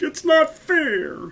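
put-on high, drawn-out childish wail; tantrum cry. A narrator's reading. Young duke after all his arrows fail, tantrum cry. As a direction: whine it loud, stretching the words. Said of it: "cried the Grand Duke".

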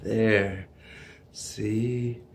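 soft and low, settling himself; calming down. Real, not acted. Excited fan calming himself before the game, soft and low. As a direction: breathe it down to calm. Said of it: "I better just calm".

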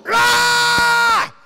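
loud, very high mock scream; demonstrated scream. Shown as a demonstration. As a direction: burst into a comic yell. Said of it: "Or are you like".

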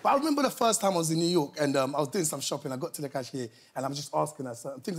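ordinary talk, nothing marked

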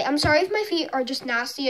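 normal talking voice

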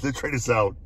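usual speaking voice

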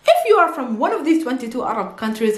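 usual speaking voice

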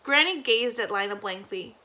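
plain reading voice

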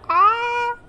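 high, loud yelp; short shriek of alarm; startled scream. Real, not acted. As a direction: scream in sudden fright. Startled by creatures.

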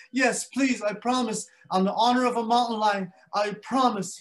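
high, loud earnest promise; lion swearing on his honor; pleading lion. Acted. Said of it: "he goes".

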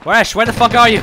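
calling out. Real, not acted. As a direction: shout across the field. Calling lost teammate under fire.

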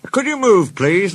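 high, polite shouted request; calling out. A narrator's reading. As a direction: call across the field to the train. Fielders call to the train.

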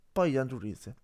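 usual speaking voice

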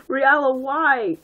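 high, drawn-out wail; hammed-up plea, stretched out; mock anguished cry. A narrator's reading. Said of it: "I cried".

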